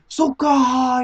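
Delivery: high, loud mock "aha"; exaggerated dawning-understanding exclamation; sudden realisation. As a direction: imitate someone finally getting it. Acts out clerk finally understanding.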